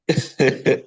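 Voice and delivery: helpless giggling fit; real laughter. Real, not acted. Speaker amused by his own wedding dress question, giggling. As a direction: break into a chuckle you can't explain. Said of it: "I don't know why I'm laughing".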